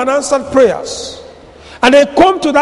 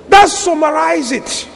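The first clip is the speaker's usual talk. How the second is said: ordinary delivery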